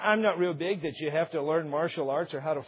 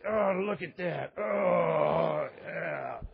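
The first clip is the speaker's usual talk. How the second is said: hammed-up awe at her muscles; playful pretend swoon; mock admiration; much slower than usual. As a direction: fake being impressed. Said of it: "play the part, just".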